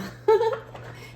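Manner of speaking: short high laugh of delight; a quick, bright burst of a laugh at the cards; surprised laugh. Real, not acted.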